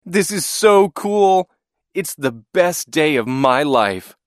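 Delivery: high, slow, stretched-out exclamations; excited yell. A narrator's reading. Boy on his first plane ride, yelling excitedly. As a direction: start high and loud, ease slightly, still thrilled. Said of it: "I yelled".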